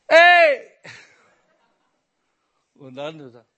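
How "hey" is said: loud high squawk in a strange voice; parrot yelling. Acted. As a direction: screech it like the parrot again. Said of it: "ruft wieder was". (lauter, schriller Papageienschrei in fremder Stimme)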